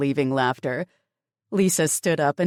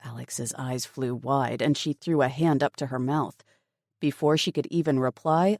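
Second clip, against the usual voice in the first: plain reading voice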